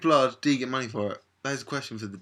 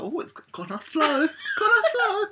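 impressed nurse; 5 dB louder than usual. Retold or imitated. Imitating the nurses impressed by the blood flow, very high. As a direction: coo over the flow.

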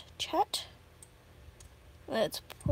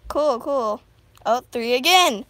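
exasperated; 9 dB louder than usual. Real, not acted. Exasperated at viewer count.

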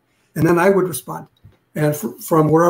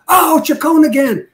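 higher, louder mocking groan about the detective; mock exasperation; 8 semitones higher, 4 dB louder than usual. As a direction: ham up their annoyance at him.

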